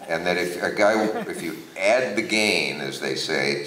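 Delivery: normal talking voice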